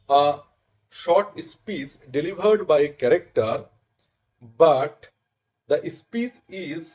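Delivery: very slow, measured dictation delivery; formal definition reading. Shown as a demonstration. Teacher reading students the definition of aside, slow and deliberate. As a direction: spell the definition out slowly, pausing between phrases.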